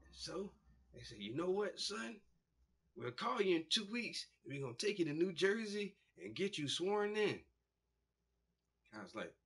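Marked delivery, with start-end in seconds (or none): 1.2-7.4 bright, louder, raised-pitch pitch; salesy recruiter voice. Retold or imitated. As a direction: sell it like an eager recruiter.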